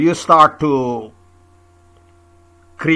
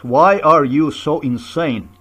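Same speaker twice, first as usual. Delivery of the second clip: ordinary talk, nothing marked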